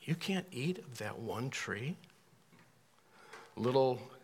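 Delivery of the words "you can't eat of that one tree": "can't" and "that one tree" stressed, softer, doubting question; Satan's emphasis. Shown as a demonstration. Preacher voicing Satan's question, softer and sly. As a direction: twist it into a sly negative question. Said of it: "Little different emphasis there".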